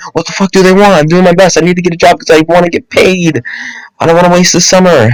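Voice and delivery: plain reading voice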